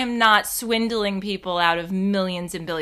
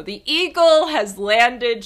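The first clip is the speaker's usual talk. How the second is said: louder, slow, radio-style announcement; mock mission report; 4 semitones higher, 8 dB louder, slower than usual. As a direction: declare it like ground control.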